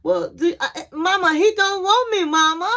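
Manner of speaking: hammed-up crying whine; retelling a daughter's crying to her mom, in a mock sob; imitating crying. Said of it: "So she started crying".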